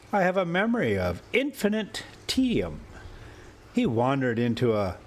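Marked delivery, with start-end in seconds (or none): none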